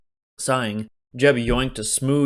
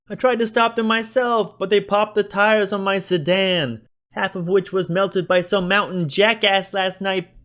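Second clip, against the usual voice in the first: much higher, louder whining demand; whiny city shopkeeper; 11 semitones higher, 6 dB louder than usual. A narrator's reading. Joe demands Berkeley earn his protection money, whining. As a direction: whine and push back hard. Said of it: "Joe whined in his thick city accent".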